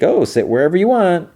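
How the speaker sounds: high-pitched imitation of a smiling hostess; smiling welcome. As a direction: welcome guests in a lifted, put-on voice. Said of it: "she smiles".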